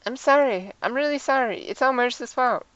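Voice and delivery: put-on high-pitched, contrite girl character; apologetic Maris. Voices Maris apologizing in the game.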